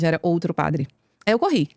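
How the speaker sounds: normal talking voice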